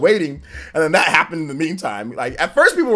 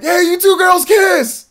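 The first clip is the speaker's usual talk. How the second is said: high, loud, slow heckling shout; rowdy yell; 13 semitones higher, 8 dB louder, slower than usual. Retold or imitated. Drunk guys heckling two girls above them, loud high shout. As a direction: holler up at the skybox like a drunk fan. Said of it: "and they're like".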